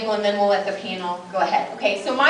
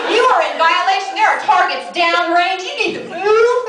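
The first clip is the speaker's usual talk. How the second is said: mock drill sergeant; 8 semitones higher, 5 dB louder than usual. Sleepwalking husband barking range orders at his wife. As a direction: bark it like a range officer.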